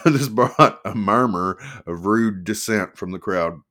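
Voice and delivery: usual speaking voice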